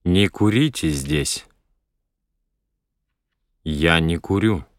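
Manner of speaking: usual speaking voice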